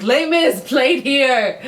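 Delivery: lightly hammed-up proclamation; mock plaque announcement. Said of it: "They'll be like".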